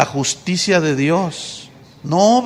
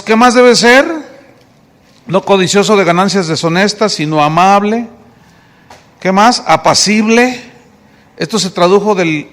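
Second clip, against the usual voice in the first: normal talking voice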